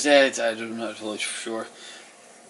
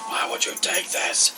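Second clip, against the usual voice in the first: high, strained mock scream at no great volume; 12 semitones higher than usual. Townsfolk dragging the thief off, mock screaming at him. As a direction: screech it as an outraged accuser, held back in volume. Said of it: "they're yelling and screaming at him".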